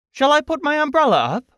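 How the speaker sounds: put-on very high, eager storybook child; child calling up. Christopher Robin offers Pooh his umbrella, high eager question. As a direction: ask upward in a high voice.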